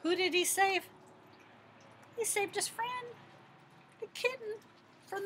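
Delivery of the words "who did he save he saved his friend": ordinary delivery, nothing marked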